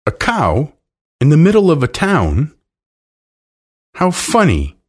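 high-pitched, incredulous questioning; amazed. A narrator's reading. Jane hearing of a cow in town, amazed. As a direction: marvel at it, pitch climbing high.

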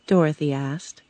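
usual speaking voice

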